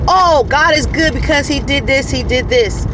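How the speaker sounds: lifted, mock-testifying exclamation; mimicked testimony praise. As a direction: act out someone praising at testimony time. Voicing other people's testimonies.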